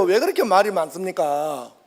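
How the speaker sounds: ordinary delivery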